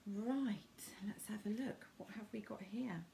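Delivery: whispered aside while turning over a card; hushed. Real, not acted. Tarot reader to her viewers, whispering as she looks at the card. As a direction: breathe it under your voice, almost to yourself.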